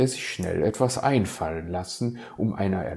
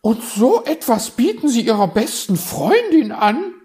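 very high, louder outraged question; appalled magpie; 10 dB louder than usual. Acted. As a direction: sound scandalised by the offer. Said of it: "war Frau Elster entsetzt". (entsetzte Elster, sehr hoch und laut)

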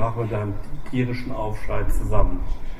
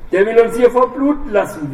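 high, frightened whimper; 11 semitones higher, 6 dB louder than usual. A narrator's reading. Wounded biker whimpering in fear, high. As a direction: panic through pain. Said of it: "wimmerte der mit dem zerschossenen Bein".

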